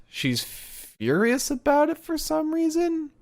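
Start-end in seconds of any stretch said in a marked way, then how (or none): 0.4-1.5 FURIOUS stretched out high; drawn-out emphasis. Real, not acted. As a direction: hold the start of "furious", pitched way up. Stretched word stressing her fury.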